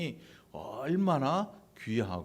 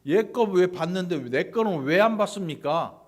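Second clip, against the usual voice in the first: loud, higher indignant challenge, then easing; confrontational protest; 4 semitones higher, 7 dB louder than usual. Retold or imitated. Cain disputing with God over his rejected offering, retold confrontational protest. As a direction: demand an explanation, as a retold complaint. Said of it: "라고 따지는 거예요".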